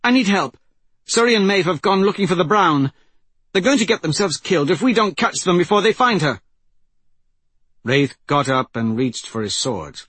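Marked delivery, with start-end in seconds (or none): 0.0-6.4 high, loud plea for help; urgent shouting. A narrator's reading. Persephone begs help before the two get killed, urgent shout. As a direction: raise the alarm with urgency. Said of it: "Persephone shouted".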